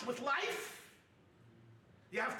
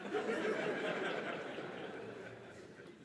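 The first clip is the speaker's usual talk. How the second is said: audience laughter. Real, not acted. Audience reacts to joke.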